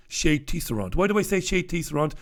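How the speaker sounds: normal talking voice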